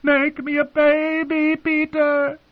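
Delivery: shrieking plea, very high and drawn out; screamed in passion. A narrator's reading. Aunt begging her husband for a child, high passionate scream. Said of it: "she screamed".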